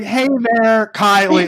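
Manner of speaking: a hint of an automated voice; computerized voice. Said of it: "the computerized voice".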